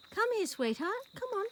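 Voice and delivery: high, sweet coaxing call. Real, not acted. Owner coaxing her horse, high sweet call. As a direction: coax him gently, up high.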